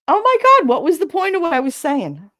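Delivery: high, loud burst of frustration at losing the thread; exasperated outburst. Real, not acted. Loses her train of thought.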